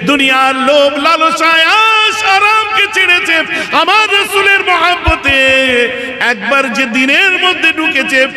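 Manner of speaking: high, loud chanted climax; soaring, slow preacher's cry to the crowd; full-force preaching. Acted. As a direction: belt it out in long-held notes.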